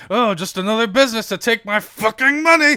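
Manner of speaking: hot-tempered smith roaring about money; furious outburst. Acted. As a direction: fire Genasi flares up, shouting. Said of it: "you see the fire, like, flare up".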